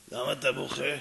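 low, contained inquiry; nosy stranger, first calm ask; stranger's question. Acted. Stranger first asks the crying man.

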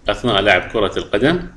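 ordinary talk

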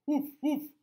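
loud high woof woof; dog bark. Acted. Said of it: "barked the dog".

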